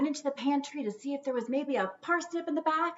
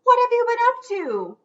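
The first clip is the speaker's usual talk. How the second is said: higher, louder suspicious mother; Gug scolding; 11 semitones higher, 8 dB louder than usual. Acted. Gug questioning the ghostlings, suspicious and louder. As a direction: catch them out with a knowing demand.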